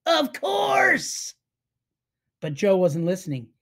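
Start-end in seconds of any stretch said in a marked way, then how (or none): 0.1-1.3 sudden realization. Acted. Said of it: "Matt slapped his forehead".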